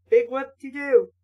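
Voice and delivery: ordinary delivery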